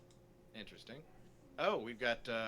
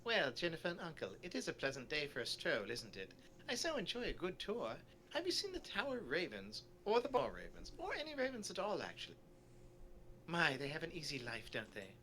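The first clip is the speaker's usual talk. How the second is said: chatty, lilting storybook tourist; polite small talk with swooping pitch; genteel tourist character; 7 semitones higher than usual. Acted. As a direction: play a pleasantly sociable sightseer. Voices a tour character.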